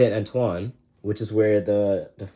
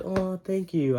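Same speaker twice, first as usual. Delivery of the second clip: polite high brush-off; 10 semitones higher, slower than usual. Retold or imitated. Lucy politely brushing him off.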